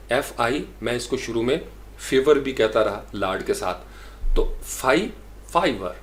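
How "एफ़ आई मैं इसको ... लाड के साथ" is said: ordinary talk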